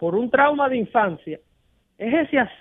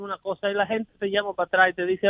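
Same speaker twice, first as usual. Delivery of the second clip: normal talking voice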